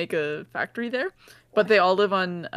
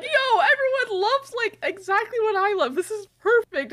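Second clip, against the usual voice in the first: mock delighted; 11 semitones higher than usual. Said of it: "And he's like".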